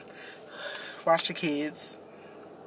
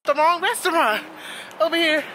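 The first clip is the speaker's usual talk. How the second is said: higher-pitched mock outrage; worked-up storytelling disbelief, pitched up; retold indignant disbelief; 7 semitones higher than usual. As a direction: retell it as an incredulous complaint. Retelling complaint about wrong restaurant.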